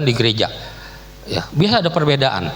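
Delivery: ordinary delivery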